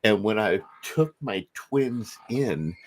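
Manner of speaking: usual speaking voice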